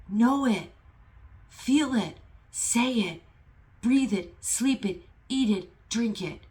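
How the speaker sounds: impassioned, high and loud; fired-up preaching from the chest; emotional exhortation. Real, not acted. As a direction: drive each short command home. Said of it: "I'm, like, getting emotional".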